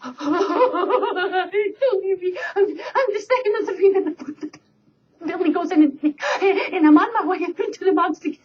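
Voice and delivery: high, stammering goat voice, laughing; pleading middle goat. Acted. Second goat begging the troll, high and stammering. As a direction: plead and stutter like a scared goat.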